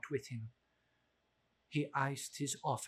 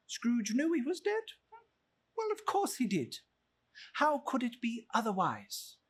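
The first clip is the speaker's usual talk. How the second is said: much higher, arch and amused; mock rhetorical question; 7 semitones higher than usual. A narrator's reading. Narrator teasing listeners about Marley, high and arch. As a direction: toss off the obvious question.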